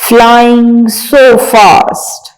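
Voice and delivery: reading a poem aloud slowly, word by word. Shown as a demonstration. Teacher reading a poem to young pupils, slow and clear. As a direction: recite each word clearly for the class. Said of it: "आइए पढ़ते हैं".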